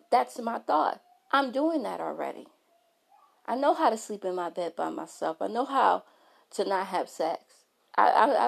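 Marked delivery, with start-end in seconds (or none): none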